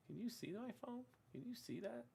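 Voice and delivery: soft, high aside; quiet aside. Real, not acted. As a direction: ask quietly to yourself. Quiet aside checking phone.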